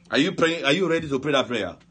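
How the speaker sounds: usual speaking voice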